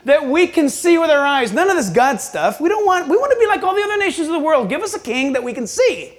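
clamoring people. Retold or imitated. Israel asking for a king, clamoring imitation. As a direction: press the demand like an insistent people. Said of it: "Didn't they say".

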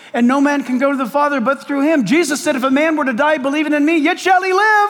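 rising pulpit fervor; impassioned preaching. Real, not acted. Preaches at full force.

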